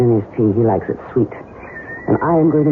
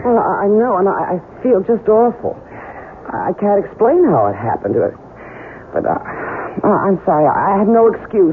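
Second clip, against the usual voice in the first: higher, stammering apology; faked remorse; 6 semitones higher than usual. Wife pretending to the doctor she feels awful, stammering. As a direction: play the flustered guilty wife.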